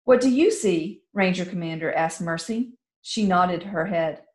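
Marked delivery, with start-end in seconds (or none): 0.0-1.0 higher, firm question; commander's question. Acted. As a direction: commander asking the princess. Commander asks Mercy.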